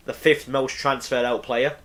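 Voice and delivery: normal talking voice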